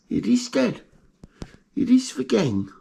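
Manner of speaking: squeaky high little-rat voice, cheerful; pet rat's voice. Pretend. Pet rat Greggy answering his owner, squeaky and high. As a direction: answer Dad as a tiny proud rat.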